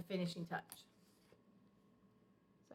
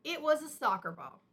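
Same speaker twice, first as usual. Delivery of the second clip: higher, louder blurted confession; eager confession; 5 semitones higher, 5 dB louder than usual. A narrator's reading. Sister Bear confesses to Mama, higher and louder. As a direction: blurt out the truth at last. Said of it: "said sister".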